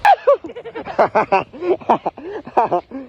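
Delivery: high breathy laugh. Real, not acted. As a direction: laugh it out after the ride. Laughing after the run.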